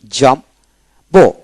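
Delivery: Jumbo as two separate beats, jam and bo; split into syllables. Shown as a demonstration. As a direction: leave a gap inside jumbo after jam. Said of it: "এভাবে jumbo".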